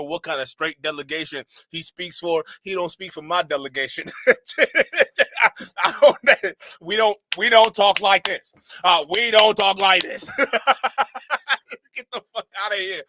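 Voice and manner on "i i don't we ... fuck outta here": loud, high objection through laughter; laughing protest. Real, not acted. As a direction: protest loudly while cracking up.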